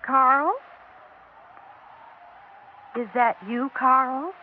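calling out questioningly into an empty house. Acted. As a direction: call up the stairs, uneasy. Calls to unseen husband.

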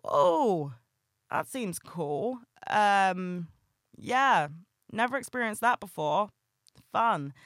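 flat, slow sarcastic reply; underwhelmed, dryly sarcastic; deadpan sarcasm. Retold or imitated. Answering friends' family-party stories with dry sarcasm. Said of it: "And I was just kinda like".

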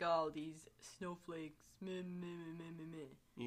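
slow mock whine of opponents; whiny dismissive meh meh. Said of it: "they're just gonna be like".

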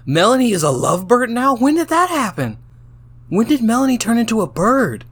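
very high, incredulous outburst; shocked disbelief. Real, not acted. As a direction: squeal the questions in real bafflement. Shocked by the story's twist.

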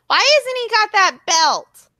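high, loud, slow mom complaint; mock grumbling mom. Daughter imitates her mom complaining about Bray, high and loud. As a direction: grouse like a fan who feels robbed. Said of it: "She's like".

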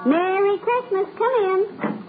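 high, slow sing-song welcome; cheery door greeting. Acted. Molly greets guest at door.

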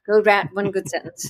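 lightly imitated South African accent; "write" as "rat", a hint of South African. Student quoting her writing teacher's assignment, light South African accent. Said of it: "in her South African accent".

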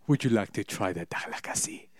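hammed-up, clumsy African accent; badly faked African accent. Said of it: "That's a bad accent".

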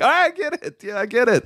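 high, exasperated I get it; mock weary high voice. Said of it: "I'm like".

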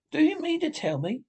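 high, incredulous exclamation; mild shout, pitched right up; excited shout. A narrator's reading. Rat thumping the table at Toad, shouting in disbelief. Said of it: "shouted the rat, thumping his little fist".

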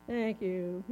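low, subdued mock-child mumble; reluctant child's thanks. Child prompted by a parent to say thanks, reluctant mumble.

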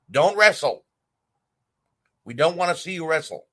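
heated plea to stop wrestling; exasperated demand. Real, not acted. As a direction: wave her out of the ring. Telling Lana to quit wrestling.

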